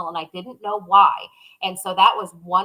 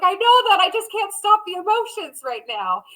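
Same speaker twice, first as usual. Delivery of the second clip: mock tearful cry; 11 semitones higher than usual. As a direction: imitate yourself about to cry. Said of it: "started crying 'cause I was just overly emotional".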